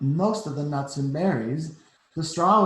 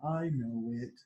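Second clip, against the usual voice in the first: soft, lower shy reply; 5 semitones lower, 6 dB quieter, slower than usual. A narrator's reading. Frederick answering the mice's praise, soft and shy. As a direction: say it bashfully. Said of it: "he said shyly".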